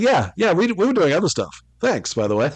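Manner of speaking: mock mogul reply. Voicing the moguls' offhand reply.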